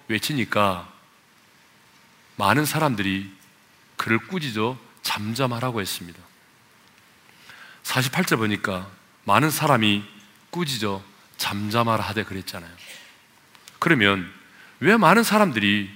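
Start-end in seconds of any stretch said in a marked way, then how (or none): none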